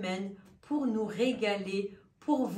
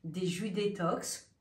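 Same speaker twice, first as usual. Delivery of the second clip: ordinary delivery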